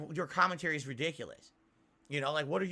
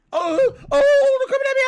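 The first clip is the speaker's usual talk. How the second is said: shrill, much higher and louder fake panic scream; mock scream; 17 dB louder than usual. Mocking a YouTuber screaming at monsters, hammed-up high scream. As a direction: shriek it like a scared streamer.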